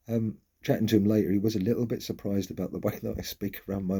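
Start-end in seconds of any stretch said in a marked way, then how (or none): none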